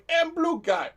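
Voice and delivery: high and loud mock alien voice; [name]'s Chiss voice. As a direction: pitch it up high and loud like a cartoon blue alien. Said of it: "just [name] going".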